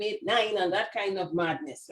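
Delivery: ordinary delivery, nothing marked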